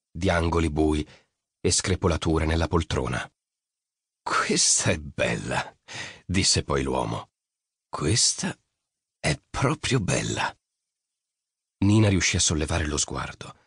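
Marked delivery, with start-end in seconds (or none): 4.2-5.8 voce bassa e lentissima da uomo-uccello; uomo misterioso. A narrator's reading. Mercurio, uomo-uccello, parla a Nina. 7.9-10.5 un accenno di voce d'uccello, lenta; uomo misterioso. A narrator's reading. Mercurio ripete, assaporando.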